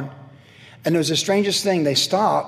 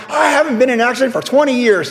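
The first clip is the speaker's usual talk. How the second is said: higher, louder proud brag; mock self-satisfied husband; smug boast; 8 semitones higher, 6 dB louder than usual. Husband boasting to his wife about his record, smug. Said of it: "And I'm like".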